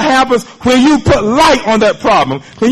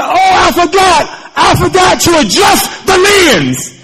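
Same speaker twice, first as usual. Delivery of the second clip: higher, louder, slow cry of discovery; sudden realization; 10 semitones higher, 4 dB louder than usual. Retold or imitated. Student realizing her mistake to her teacher, excited. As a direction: exclaim it as if it just dawned on you. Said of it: "And she said".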